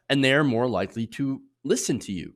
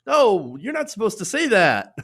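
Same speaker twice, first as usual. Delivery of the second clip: high, loud startled "whoa, no"; a donor caught off guard, hammed-up; mock startled donor; 11 semitones higher, 7 dB louder, slower than usual. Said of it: "was like".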